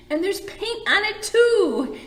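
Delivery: high, loud, slow complaint; exasperated mother. Acted. Mother scolding Joseph over his vest, exasperated. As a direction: pile on the grievance.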